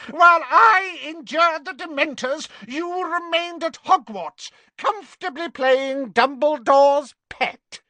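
high, loud furious witch. A narrator's reading. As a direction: spit it out in fury. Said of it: "In her fury".

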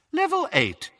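high, cool announcement; detached, high-pitched female announcer; cool witch's voice. Acted. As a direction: announce it like a lift voice. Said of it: "said the witch's cool voice".

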